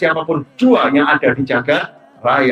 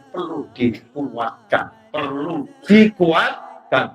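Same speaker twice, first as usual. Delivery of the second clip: drawn-out emphasis; 3 dB quieter, much slower than usual. Real, not acted. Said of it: "slowed emphasis".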